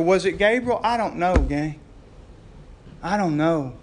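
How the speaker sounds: usual speaking voice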